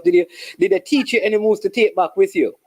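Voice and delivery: normal talking voice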